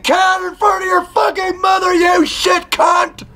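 very high, drawn-out shriek; sudden mock-furious scream out of nowhere; screaming obscenities. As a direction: shriek it slowly, every word stretched. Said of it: "you scream something god-awful".

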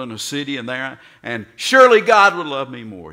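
loud, high, eager boasting; mock zealous bragging; eager striver. Imagined believer trying earning love.

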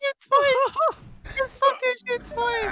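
shocked yell. Real, not acted. Shocked reaction in game.

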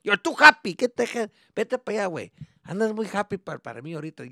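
loud, high irritated Spanish; scolding dad. Retold or imitated. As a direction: shoo the kid away.

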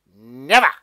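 loud refusal. Acted. Stalk refuses Jack.

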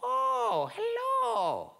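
very high sweet greeting; welcoming old woman. Acted. Old woman opens door to stranger, high-voiced welcome. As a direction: sing out a high hello.

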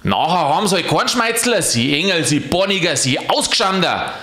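cursing. Acted. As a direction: fire off the names. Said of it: "rief Aloysius".

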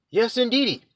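ordinary talk, nothing marked

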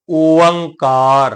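ਓੰਕਾਰ slowly, as long o-ang-kaar; pronunciation demo. Shown as a demonstration. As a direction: stretch both long vowels in ਓੰਕਾਰ, with nasal ng. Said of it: "ਇਹਦਾ ਉਚਾਰਨ ਕਰਨਾ ਹੈ". (ਓੰਕਾਰ ਨੂੰ ਲਮਕਾ ਕੇ ਬੋਲੋ)

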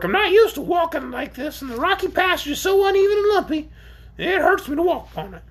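shrill, high-pitched creature grumble; grumbling. A narrator's reading. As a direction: whine about it as the aggrieved orc. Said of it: "grumbled the orc".

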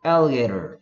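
alligator with a flapped t and an r ending; American alligator, slow and relaxed; American accent. Shown as a demonstration. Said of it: "미국 사람들한테 시키면".

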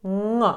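nasal NG sound for "н", no hard G. Shown as a demonstration. Teacher showing learners the first NG sound, nasal. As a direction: hum "н" through the nose. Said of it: "це носовий звук".

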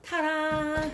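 high showman ta-da; ta-da reveal. Real, not acted. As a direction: announce it with a flourish. Oven reveal.

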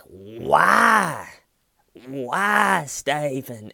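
high, loud, drawn-out pleading; exasperated mock wail. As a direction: wail it like a detective at his wits' end. Exasperated detective wailing at Steven.

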